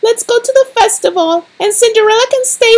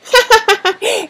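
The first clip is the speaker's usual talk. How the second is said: short, mean giggle; mocking laugh; 3 semitones higher than usual. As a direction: snicker at her. Stepsister mocks Cinderella.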